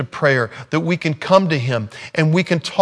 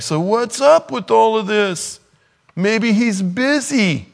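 high, slow, drawn-out taunt; mocking Elijah; 7 semitones higher, slower than usual. Elijah to the Baal worshipers, high slow mockery. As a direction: stretch it out in a high, teasing voice. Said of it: "There's Elijah saying".

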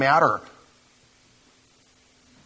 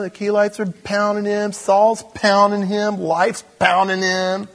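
sarcastic, pitched up and hammered harder as it builds; sarcastic mock lament; 8 semitones higher than usual. Preacher mocking the best-life gospel to the congregation, sarcastic. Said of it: "That was sarcasm".

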